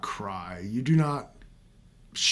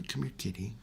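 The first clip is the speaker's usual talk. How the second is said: soft coaxing kitty voice, much softer; coaxing a cat; 4 semitones lower, 14 dB quieter than usual. Pretend. Calling the meowing cat to him, soft and coaxing. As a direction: gently call the cat over. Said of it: "It makes me be".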